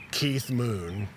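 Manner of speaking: ordinary delivery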